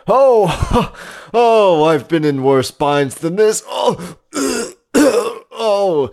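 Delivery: high groans of agony, hammed up; pained groaning. Mangled hero at pit bottom, hammed-up groaning. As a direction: groan through the pain, voice jumping high.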